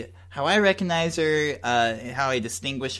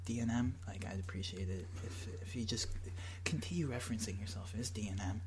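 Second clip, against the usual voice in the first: whispering; 5 semitones lower, 15 dB quieter than usual. Acted. Whispered reply.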